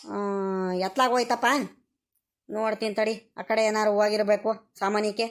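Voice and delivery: ordinary talk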